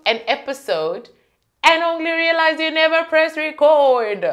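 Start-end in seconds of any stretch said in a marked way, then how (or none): 1.6-4.2 exasperated. Real, not acted. Dramatic exasperation.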